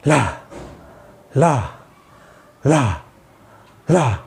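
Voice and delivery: ला with a sharp puff of air from the mouth; air-pushed la. Shown as a demonstration. Therapist demonstrating ला for pupils, pushing air. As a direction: push ला out of the mouth, not the nose. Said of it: "जैसे".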